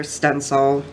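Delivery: usual speaking voice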